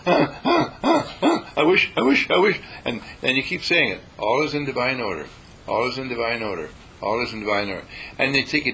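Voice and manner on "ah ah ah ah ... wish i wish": high, strained whimpering wishes; hammed-up anxious whimper; mock panic. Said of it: "At first you say".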